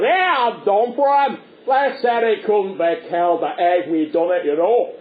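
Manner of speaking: triumphant crocodile in broad Ulster, slow at first; Ulster accent. Acted. Crocodile boasts of killing Punch, gloating Ulster accent. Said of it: "configure the crocodile with an Ulster accent".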